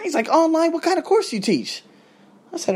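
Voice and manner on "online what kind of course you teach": high-pitched imitation of a curious student; retold incredulous question; surprised questioning. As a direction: jump up in pitch on the query.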